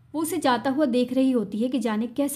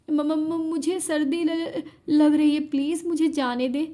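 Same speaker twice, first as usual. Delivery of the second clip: stammering through chattering teeth, higher and slow; shivering plea; 4 semitones higher, slower than usual. Acted. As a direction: beg to leave, shivering with cold and fear. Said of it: "कपकपाते नीले होठों के साथ".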